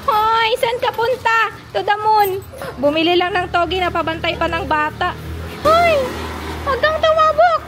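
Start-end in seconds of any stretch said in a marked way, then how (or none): 0.1-2.3 calling from far away. Real, not acted. Greeting a child from afar. 5.6-7.6 calling from far away. Real, not acted. Warning child not to cross.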